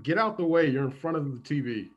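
normal talking voice

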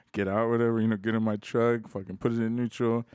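usual speaking voice

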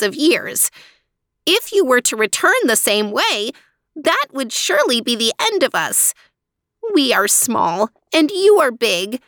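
high, earnest little mouse voice, rising to the end; pleading mouse. Acted. Mouse pleads with the Elephant King, high earnest voice. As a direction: beg the big king kindly. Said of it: "said this mouse".

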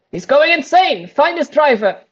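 loud, high urgent security guard shout; theater security voice. Acted. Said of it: "theater security voice".

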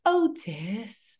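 soft, lightly voiced British mother calling her son; a hint of the mother's accent, hushed; imitating Otis's mom. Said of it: "I love the way she speaks".

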